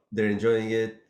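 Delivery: ordinary talk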